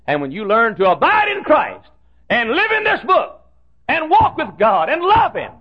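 high, loud revival preaching; full-force preaching. Acted. Preacher rousing the congregation, in loud high preaching.

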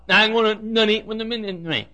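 mushy garbled ministry as minimi; mock tongue-tied. Preacher mimicking Joe's garbled speech, light mockery. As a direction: blur the consonants like Joe. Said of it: "can't even talk plainly".

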